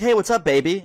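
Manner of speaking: fake smile. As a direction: imitate her putting on a smile. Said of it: "she has, like, this fake smile on".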